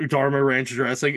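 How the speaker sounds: ordinary talk, nothing marked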